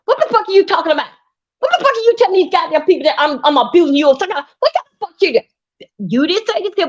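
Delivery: indignant mock tirade. Voicing M U's furious retort to Teresa. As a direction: rip into her, outraged. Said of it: "I'm saying it in the Dover Nietzsche way".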